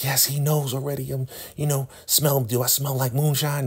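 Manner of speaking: scared high-pitched imitation; mock panic in a put-on high voice; Joan. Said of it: "she is so scared now".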